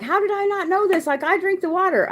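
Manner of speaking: high, alarmed squeal of disbelief; sudden panic, voice jumping up high; panicked disbelief. Retold or imitated. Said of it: "I get mad 'cause I'm like".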